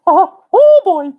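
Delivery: high, loud cartoon mouse chuckle; Mickey Mouse. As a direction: squeak it out as a jolly cartoon mouse. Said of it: "Mickey Mouse laugh".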